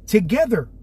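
usual speaking voice

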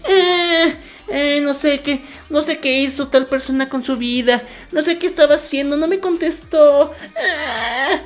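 very high, squeaky gremlin voice, crying with laughter; shrill mock-crying gremlin, laughing through it; gremlin whine. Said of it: "me estoy convirtiendo en un gremlin".

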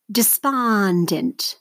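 "Despondent" very slowly, SPON drawn out; stress on second syllable. Shown as a demonstration. Teacher models for seventh graders to mark, slow stressed SPON in "Despondent". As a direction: say "Despondent" as de-SPON-dent, stretching the middle. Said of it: "circle the stress syllable".

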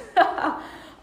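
high, short burst of laughter; real high-pitched giggle; amused laugh. Real, not acted. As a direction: laugh out loud briefly. Laughs after shared joke.

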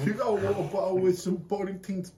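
put-on British bloke accent; mock British accent. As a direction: ask for ale like a Brit. Joining British bit.

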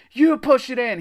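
high, very loud retort; shouting. A narrator's reading. Jack refuses to touch the blob, shouting. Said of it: "he shouted".

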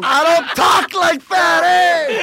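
loud, high, drawn-out denial; laughing, outraged holler; indignant protest. Real, not acted. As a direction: shout back at the teasing. Indignant protest at being imitated.

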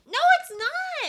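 protest, genuinely appalled; indignant protest. Real, not acted. Friend objects that starving koalas aren't funny, high indignant protest.

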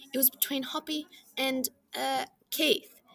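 usual speaking voice

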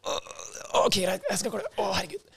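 mock exasperated relief; giving in. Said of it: "Så det blir sånn".

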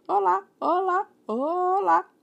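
high, loud parrot squawk from the chimney; parrot calling. Acted. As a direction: call out like a parrot from inside. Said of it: "o papagaio chamou lá de dentro".

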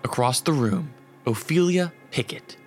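usual speaking voice

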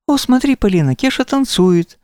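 high, bright delighted call; excited exclaim. A narrator's reading. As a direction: show her the dancing bear. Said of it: "воскликнула она".

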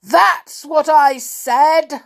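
high, loud and slow angry shout; bad-tempered giant's roar; thundering, bad-tempered. A narrator's reading. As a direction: thunder each word at the servant. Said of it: "thundered the bad-tempered giant".

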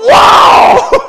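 huge, high, long whoa; awestruck shout. Acted. Preacher relives being struck by scripture in bed, shouting. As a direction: shout it out as if hit by a revelation.

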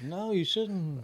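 high, slow mocking voice; drawn-out put-on voice, high; mock scolding. Mocking his friend's warning, high and slow. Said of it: "I'm gonna be like".

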